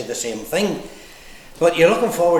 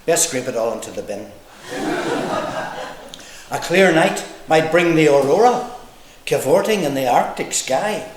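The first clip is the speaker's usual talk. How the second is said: measured, rhythmic verse reading; comic poem recital; 4 dB louder than usual. Acted. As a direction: recite it in lilting lines with pauses. Reads own poem to audience.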